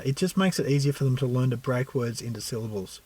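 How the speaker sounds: ordinary delivery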